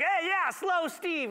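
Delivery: high, slow teasing call of a nickname; mocking taunt. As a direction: drag out the taunt like a schoolyard kid.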